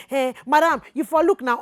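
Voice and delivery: loud, high pidgin protest; mock complaint. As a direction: biker insisting she look. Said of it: "My guy is still talking".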